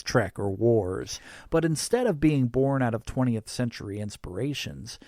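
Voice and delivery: ordinary delivery, nothing marked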